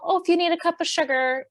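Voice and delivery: breezy parody of shallow neighborly talk; mock polite neighbor. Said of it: "not just like".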